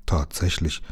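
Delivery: low, soft murmur. A narrator's reading. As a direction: murmur it under your breath. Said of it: "raunte Lukas". (leise geraunt)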